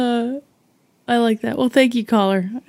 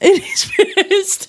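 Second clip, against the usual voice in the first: laughing echo; 7 semitones higher, slower than usual. Acted.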